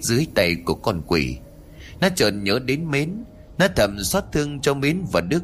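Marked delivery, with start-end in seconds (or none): none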